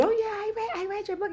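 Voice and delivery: high, soft put-on chirp; reader's voice. Reader telling author she read her book, light high imitation. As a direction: imitate a casual fan in a small high voice.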